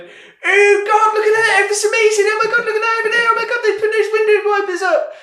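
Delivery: very high, loud, squealing glee with laughter; shrieking high-pitched excitement, giggling; squealing delight. Retold or imitated. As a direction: gush like overexcited lads at a prank. Reprobates delighted by a prank.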